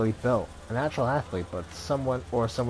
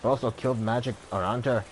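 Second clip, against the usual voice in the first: put-on Dublin accent. Ex-ranger tells a stranger her story, Dublin accent. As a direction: tell your past in a Dublin lilt. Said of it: "Born and raised in Dublin".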